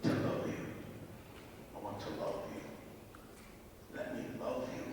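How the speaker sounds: very soft, slow and intimate; tender near-whisper. Retold or imitated. Horse answering the man who asked, tender and hushed. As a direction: breathe it out gently, barely voiced.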